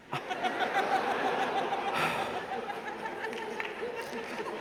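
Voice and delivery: long breathy groan of despair; long exasperated sigh. Real, not acted. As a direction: let out a long defeated sigh. Devastated by boss's joke.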